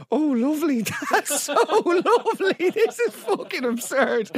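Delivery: high-pitched, laughing mock swoon; hammed-up high coo, cracking up; girlfriend's brother, mocked. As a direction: parody a delighted brother being nuzzled. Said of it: "your girlfriend's brother going".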